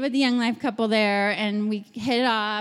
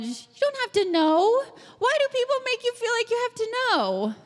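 much higher, rising indignant plea; high incredulous why; exasperated plea; 11 semitones higher than usual. Real, not acted. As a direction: protest on their behalf. Reassuring college students in audience.

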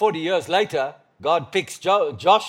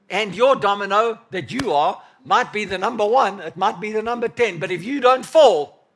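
high, forceful sermon delivery; impassioned preaching; 5 semitones higher, 4 dB louder than usual. Real, not acted. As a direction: preach it hard, pitched up and driving.

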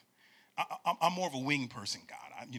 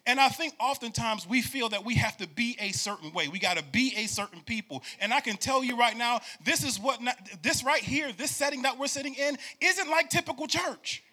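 rising, louder preacher cadence, almost singing; full-force preaching; 8 semitones higher, 5 dB louder than usual. Acted. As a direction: build the sermon to a shout. Preaching to congregation.

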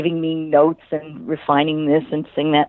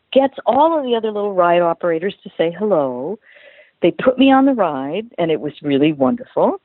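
usual speaking voice

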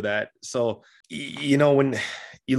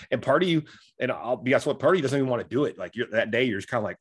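usual speaking voice

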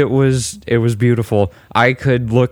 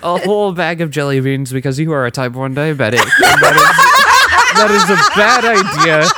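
high laughing mock scolding; 5 semitones higher, 4 dB louder than usual. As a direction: scold yourself while cracking up. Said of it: "the responsible side of me".

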